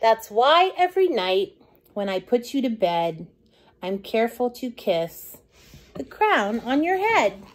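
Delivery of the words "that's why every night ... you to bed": ordinary delivery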